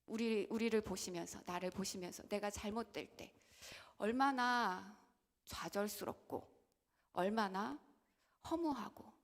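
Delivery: ordinary delivery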